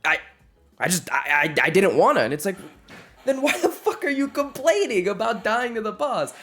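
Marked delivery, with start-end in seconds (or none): 0.0-2.2 high, stammering mock defensiveness; defensive excuse. Acts cornered gamer making excuses. 3.2-6.3 very high-pitched, hammed-up exasperated cry; exasperated outburst. Acted friend's exasperated outburst.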